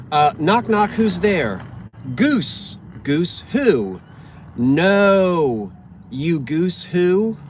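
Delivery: loud, high and stumbling knock-knock read; garbled first read. Real, not acted. Reading a knock-knock joke card, she blurts it loud and jumbled. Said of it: "let me read that again".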